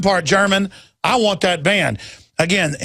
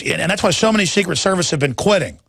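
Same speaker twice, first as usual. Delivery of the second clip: normal talking voice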